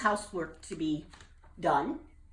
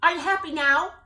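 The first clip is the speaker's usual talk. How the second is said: high, loud eager bullfrog; eager question; 11 semitones higher, 11 dB louder than usual. Acted. Jubal asking the captain, high and eager. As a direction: burst out hopeful.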